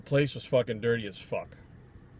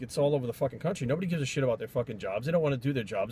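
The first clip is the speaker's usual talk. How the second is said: ordinary delivery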